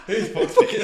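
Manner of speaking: a high, laughing disbelief; high-pitched giggling question; high laughing squeal. Real, not acted. Laughing at the lyric.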